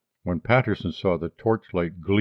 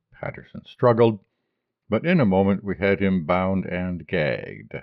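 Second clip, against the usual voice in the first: usual speaking voice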